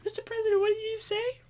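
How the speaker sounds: put-on very high, small child voice; schoolkids' voice. Kids questioning the president, very high little voices. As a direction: squeak it like an astonished pupil. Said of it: "all the kids are like".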